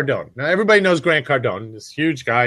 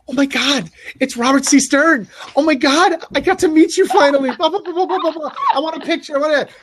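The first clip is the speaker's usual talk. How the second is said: high, gushing starstruck squeal; gushing fans; 12 semitones higher than usual. Retold or imitated. Two women meeting the speaker at the summit, gushing high. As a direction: gush over meeting a celebrity. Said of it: "They're like".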